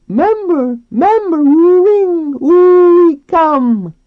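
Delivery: very high, drawn-out squealed shout; shouting dolphin. A narrator's reading. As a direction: shriek it slowly like a calling dolphin. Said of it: "a dolphin shouted".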